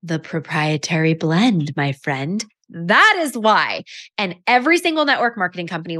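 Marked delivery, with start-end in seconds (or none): 0.0-3.8 slow, knowing, a bit mysterious; lightly hammed-up confident reply; smug sales pitch. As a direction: savour each word like a secret sauce. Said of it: "And I said".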